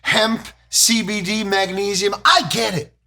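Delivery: earnest fake-concerned lecture, rising high; preachy anti-drug adult. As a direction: lecture the kid about drugs. Acted anti-drug cop sketch.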